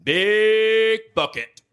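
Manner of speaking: very slow, loud big bucket; drawn-out phrase. Shown as a demonstration. Comic teaching crowd new slang, slow and loud. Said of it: "you say".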